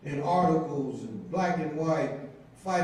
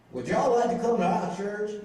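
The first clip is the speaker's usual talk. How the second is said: higher Mississippi drawl of a friendly stranger; Southern drawl imitation; 6 semitones higher than usual. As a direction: imitate the man's Southern "y'all" invitation, higher. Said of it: "I'll say it the way he said it".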